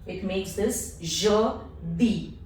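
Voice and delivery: "jeudi" slow, eu as in her. Shown as a demonstration. Teacher modelling "jeudi" slowly for learners. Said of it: "So this becomes jeudi".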